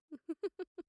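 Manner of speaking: light amused laugh; bright laugh. Acted. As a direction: laugh fondly at him. Amused at the general's door.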